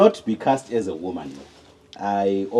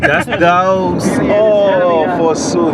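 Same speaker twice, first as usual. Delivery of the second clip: loud, high, hammy Elizabethan player; Shakespearean voice; 7 semitones higher, 9 dB louder, much slower than usual. Pretend. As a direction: declaim like a Shakespeare actor. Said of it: "Shakespearean language now".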